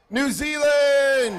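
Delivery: loud, high, drawn-out shout; crowd cheer. Real, not acted. Host presenting New Zealand on stage, cheering shout. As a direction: belt it out and hold the last syllable.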